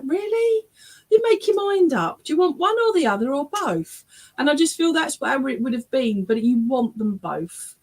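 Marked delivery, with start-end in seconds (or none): 0.1-4.0 high, teasing exasperation; much higher, playful "make your mind up"; mock exasperated. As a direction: play the parents rolling their eyes at her. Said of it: "they're like".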